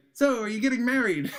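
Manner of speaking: high, loud laughing mock British tease. Friends teasing the tourist about marriage, laughing in a high voice. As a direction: ask it giggling like a nosy friend.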